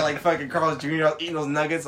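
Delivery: ordinary talk, nothing marked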